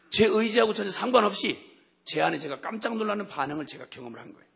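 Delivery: usual speaking voice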